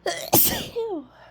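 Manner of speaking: high choked sneeze stifle; a sharp suppressed sneeze sound; stifled sneeze. Real, not acted. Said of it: "trying to hold back".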